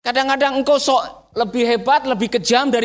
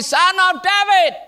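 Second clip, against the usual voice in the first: high, drawn-out cry; shouting out; 7 semitones higher than usual. Acted. Beggar shouting again to Jesus, high cry. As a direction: call out slowly, pitched up. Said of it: "Maka dia teriak lagi".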